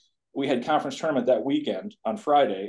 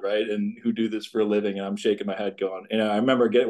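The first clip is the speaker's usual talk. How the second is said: normal talking voice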